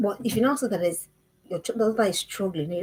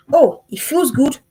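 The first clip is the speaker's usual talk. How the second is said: mock girl's impulse; 3 semitones higher, 7 dB louder, slower than usual. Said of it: "girls' thoughtless impulse".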